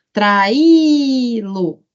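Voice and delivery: traÍÍÍ-lo, Í loud and stretched; calling the word. Shown as a demonstration. Teacher checking a hiatus, calling the word. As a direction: call out Traí-lo, holding the Í.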